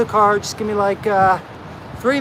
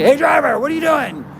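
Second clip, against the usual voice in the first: walk right up and hail the trucker; bold friendly greeting; 7 semitones higher than usual. Retold or imitated. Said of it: "No, it's like".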